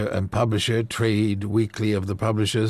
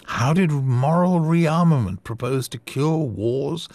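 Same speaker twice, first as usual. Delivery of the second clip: usual speaking voice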